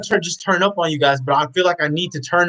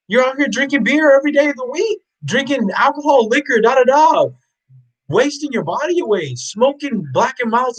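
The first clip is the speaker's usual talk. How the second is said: rising rant; 9 semitones higher than usual. Real, not acted. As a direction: pile it on, more heated with each item. Scolding list of bad habits.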